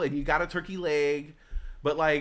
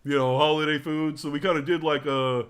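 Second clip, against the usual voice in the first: louder, breezy host voice; host's casual announcement; 6 dB louder than usual. Retold or imitated. Host telling guests about the tapas dinner, breezy and louder. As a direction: tell it like a host apologising lightly. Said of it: "they're like".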